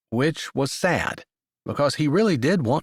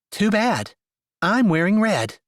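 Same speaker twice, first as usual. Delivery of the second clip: defiant; 8 semitones higher, 3 dB louder than usual. Acted. Defiant woman refusing.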